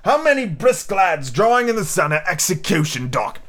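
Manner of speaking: cried reproach. A narrator's reading. Silver warns the crew of the gallows, crying out. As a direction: fling the question out as an old buccaneer. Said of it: "cried Silver".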